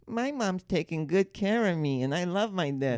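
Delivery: put-on cute baby voice. Cohost voicing a newborn's whiteboard sign in a slow baby voice. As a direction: read the whiteboard like a tiny baby. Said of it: "they're like".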